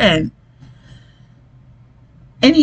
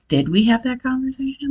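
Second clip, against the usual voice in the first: doubting herself; 3 semitones higher, 6 dB quieter than usual. Retold or imitated. Retold self-doubt after son's denial.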